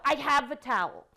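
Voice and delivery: mock-annoyed kid insisting; mild exasperated child; impatient child reply. Child's impatient replies to mother.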